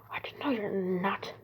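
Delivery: slow hushed whisper; slow whispered reply. Retold or imitated. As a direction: murmur it slowly. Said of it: "he's like".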